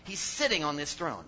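usual speaking voice

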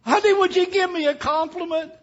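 put-on high falsetto old wife, pleading; Fanny Victoria's voice. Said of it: "she said".

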